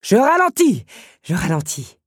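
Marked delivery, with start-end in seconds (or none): 0.0-0.8 high, loud call back; exclaimed surrender at full voice; shouted concession. A narrator's reading. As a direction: yell it to someone behind you. Beast calls back, then gives in.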